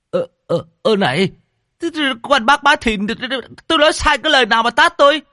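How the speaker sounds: very high, loud stammering complaint; flustered and fast accusation; indignant rich man. A narrator's reading. Rich man accuses Bá Thìn of slapping him, flustered.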